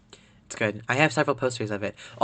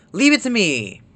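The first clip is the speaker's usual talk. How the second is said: high, loud confident reply; eager hero; 7 semitones higher, 5 dB louder than usual. Acted. Player character accepting the administrator's request, acted eagerness. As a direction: pipe up, acting keen to help.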